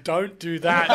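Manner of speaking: camp, sing-song mock of "Don't do that"; zesty. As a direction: ham it up, camp and loud. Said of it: "That was zesty with it".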